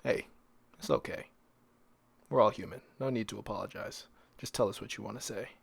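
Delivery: usual speaking voice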